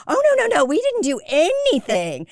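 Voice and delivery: high, loud, panicky protest; flustered denial. Retold or imitated.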